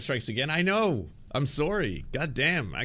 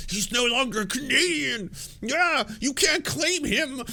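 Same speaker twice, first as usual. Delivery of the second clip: much higher, louder, slow mock-defensive whine; mocking right-wingers; 5 dB louder, slower than usual. Mocking right-wing fans defending their rapper, high huffy whine. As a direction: parody the fans' huffy denial. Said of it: "They'll be like".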